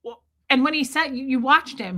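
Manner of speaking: ordinary talk, nothing marked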